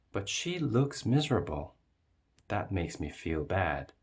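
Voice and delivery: ordinary delivery, nothing marked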